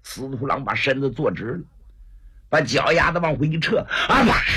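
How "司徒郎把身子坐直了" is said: plain reading voice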